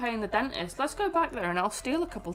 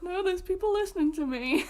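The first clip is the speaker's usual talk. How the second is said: high, strained mock protest; acting out embarrassed nerves; retold nervousness; 8 semitones higher than usual. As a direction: squeak it like a self-conscious reader. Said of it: "She's getting nervous".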